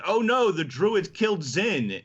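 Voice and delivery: high, drawn-out mock cry of alarm; alarmed bystander. Retelling the recording's witness to Zin's death, mock alarm. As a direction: play up a shocked onlooker, pitched high and slow. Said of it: "and someone's like".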